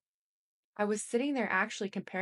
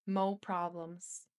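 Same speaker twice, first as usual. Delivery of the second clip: ordinary delivery, nothing marked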